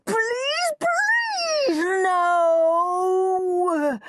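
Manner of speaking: very high, crying pleas; panicked begging. A narrator's reading. Henry begging the nurse in terror, crying. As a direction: beg in terror. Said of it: "shrieked Henry".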